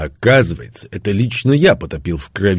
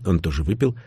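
usual speaking voice

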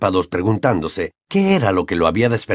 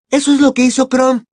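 plain reading voice

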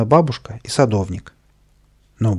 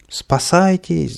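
high, slow, drawn-out cry; crowd shouting; 8 semitones higher, 4 dB louder, much slower than usual. A narrator's reading. Crowd warning everyone of mad dog, shouting. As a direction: alarm call stretched out. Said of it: "закричали в толпе".

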